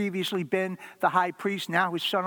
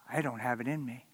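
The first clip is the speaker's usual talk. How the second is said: quiet despair; 5 semitones lower, 7 dB quieter than usual. Acted. Peter's inner realization.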